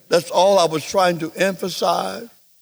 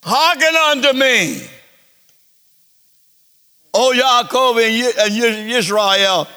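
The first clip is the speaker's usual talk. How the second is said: Yeshua speaking; 5 semitones higher, 4 dB louder than usual. Acted. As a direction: call out to Israel as Yeshua. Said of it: "That's Yeshua talking now".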